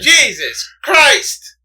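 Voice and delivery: high, loud, drawn-out groan of frustration; exasperated shout. Real, not acted. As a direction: drag it out, totally fed up. Exasperated over repeated argument.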